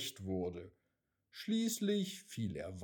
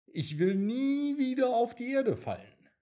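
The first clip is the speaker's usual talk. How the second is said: much higher, louder despairing little voice; distraught raindrop; 12 semitones higher, 6 dB louder than usual. A narrator's reading. Raindrop tells the cloud it never wants to fall, upset. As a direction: cry it out like an upset child.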